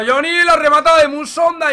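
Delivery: rising commentator excitement; excited call. Real, not acted. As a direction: jump up on the near chance. Live play, near chance.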